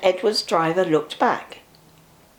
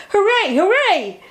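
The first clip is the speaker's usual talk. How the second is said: cheering bus; 10 semitones higher, 4 dB louder than usual. A narrator's reading. Bertie cheering again.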